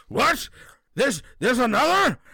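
high, startled gasp of a question; sudden eager surprise; surprised monster. Acted. Beholder reacts, excited.

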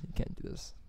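breathy hushed repeat; whispered refusal. Retold or imitated. Repeated refusal, second take.

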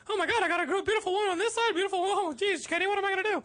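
very high, laughing cartoon mouse; giggly flustered Mickey. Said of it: "That is an awesome Mickey Mouse".

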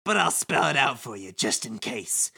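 condescending explanation building to a high boast; sly villain Kubo. Acted. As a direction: spell it out smugly, then crow. Plays Kubo reading game script.

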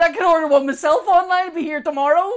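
high, loud indignant comeback; exasperated retort. Retold or imitated. Customer retorting to a five-day wait, exasperated.

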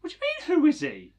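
loud, high-pitched disbelief; incredulous. Real, not acted. Host to co-host who never heard of singer, incredulous. Mock outrage at co-host's ignorance.

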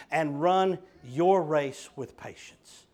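slow, leaning hard on "your"; "your" drawn out and weighted, slowly; stress on your. Shown as a demonstration. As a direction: land on "your" in "run your race". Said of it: "I emphasize the word "your".

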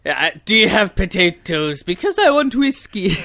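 high, hammed-up Irish imitation; mock Irish accent. Friend imitating the crew wanting whiskey, in mock Irish accent. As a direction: mimic an overdone Irish lilt, climbing high. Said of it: "turn a hard right into Irish town".

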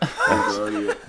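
laughing drawl. Pretend. Drawn-out joke pronunciation.

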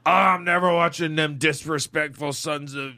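imitating a drunk's indignant rant; mock drunken outrage; drunk guy. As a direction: put on a drunk fan swearing off the game. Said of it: "He's drunk, and he's like".

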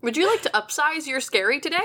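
upselling cashier. Pretend. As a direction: upsell it like a drive-thru clerk. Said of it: "mock fast-food upsell".

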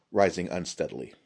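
plain reading voice

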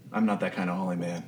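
soft, quick, subdued reply; grim holy man, low-key; sad, cold resolve. Acted. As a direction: say it quietly, with understated sadness. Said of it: "you've seen Burke very sad".

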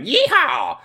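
high cowboy whoop; mock cowboy yeehaw. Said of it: "he's like".

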